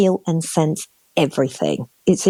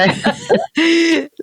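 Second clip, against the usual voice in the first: real laugh; 13 semitones higher than usual. Real, not acted. Laugh bursts out mid-story.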